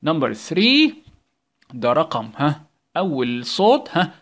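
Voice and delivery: ordinary talk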